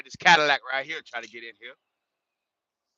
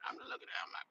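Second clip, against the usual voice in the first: hushed, breathy aside to viewers; whispered aside; 17 dB quieter, faster than usual. Real, not acted. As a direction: drop to a whisper as if he might hear. Whispered aside about the old trucker.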